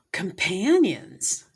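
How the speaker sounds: slow, loud, drawn-out reveal of "Companions"; dramatic announcement. Real, not acted. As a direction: announce "Companions" slowly and loudly like unveiling a prize. Reveals the card name dramatically.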